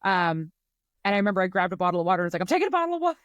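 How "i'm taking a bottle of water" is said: mock frantic. Announcing the water grab during panic, high frantic chirp.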